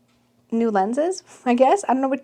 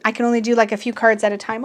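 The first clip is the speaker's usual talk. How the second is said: ordinary talk, nothing marked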